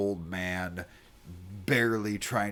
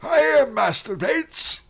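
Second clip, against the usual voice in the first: put-on high, frail old man voice; quavering elderly temple master; 10 dB louder than usual.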